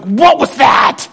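slow, loud, high amazed shout; stunned outcry. Retold or imitated. Girl stunned after passing out.

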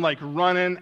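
usual speaking voice

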